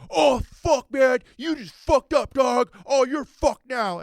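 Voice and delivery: high, loud mock yell of threats; angry surfer. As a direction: yell it like an outraged surfer bro.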